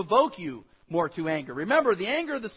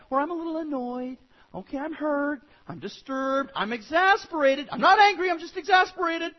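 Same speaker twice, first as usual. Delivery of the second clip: higher, louder protest building in insistence; mock denial; 6 semitones higher, 4 dB louder than usual. Pastor acting people who rename their anger, mock defensive. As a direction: protest too much, pitch climbing.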